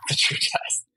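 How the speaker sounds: "It's a true test" whispered through a laugh; amused whispered repeat of "It's a true test". Shown as a demonstration. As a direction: breathe the line out with a chuckle, voiceless.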